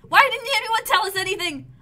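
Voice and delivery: loud, high, upset protest; indignant outburst. Acted. Colonist protesting the secret deal, indignant outburst. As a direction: demand answers from the leaders.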